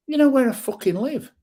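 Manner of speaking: high, loud and defiant; defiant shout. Real, not acted. Vlogger daring trolls who threaten him, shouting. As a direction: throw the challenge back at them.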